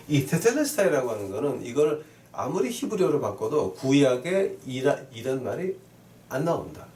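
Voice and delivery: ordinary talk, nothing marked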